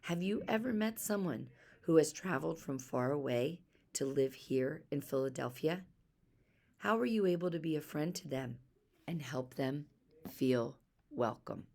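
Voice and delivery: ordinary delivery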